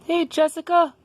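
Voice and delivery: high-pitched character voice; Daniel. Acted. Daniel hails his classmate Jessica, in a high voice. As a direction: pitch it well up for a greeting. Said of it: "Daniel was like".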